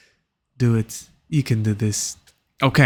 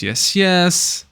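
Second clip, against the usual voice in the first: usual speaking voice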